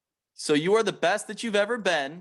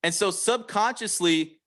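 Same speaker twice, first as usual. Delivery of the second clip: usual speaking voice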